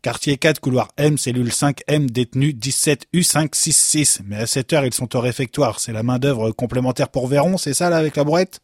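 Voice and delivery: usual speaking voice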